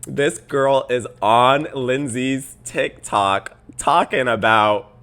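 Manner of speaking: slow, loud, exasperated; so annoyed. Real, not acted. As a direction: spell it out word by word in irritation. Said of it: "I'm getting so annoyed".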